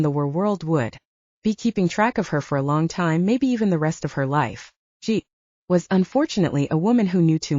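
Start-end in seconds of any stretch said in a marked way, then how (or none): none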